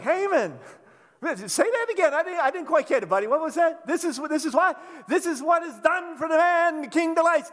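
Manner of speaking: loud, high mock-innocent teasing; teasing needling. Mordecai teases Haman, loud and mocking. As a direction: needle Haman, pretending not to hear. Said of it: "I'd be like".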